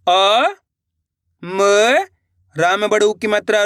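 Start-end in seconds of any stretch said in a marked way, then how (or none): 0.1-1.6 long drawn-out syllables with pauses between. Shown as a demonstration. Teacher sounding out a word for learners, syllable by syllable. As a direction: sound out each syllable slowly and separately. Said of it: "एक बार फिर से देखिए".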